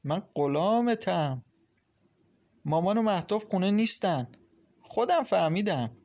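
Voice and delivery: light up at hearing his voice; happy. A narrator's reading. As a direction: Maryam overjoyed on the phone with Masoud. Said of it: "مریم با خوشحالی گفت".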